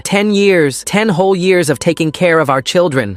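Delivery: high-pitched aggrieved rant; worked-up tirade, easing as it goes; unhinged babbling. A narrator's reading. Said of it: "him babbling like a crackhead".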